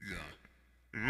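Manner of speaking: ordinary talk, nothing marked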